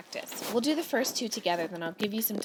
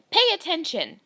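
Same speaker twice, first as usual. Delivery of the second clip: forceful command; 8 semitones higher, 8 dB louder than usual. Shown as a demonstration. Teacher demos command with feeling.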